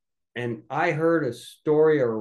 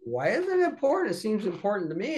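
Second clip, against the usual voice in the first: insistent protest; 8 semitones higher than usual. Retold or imitated. Son questioning his dad's dismissal, insistent protest. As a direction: press the point in a raised pitch.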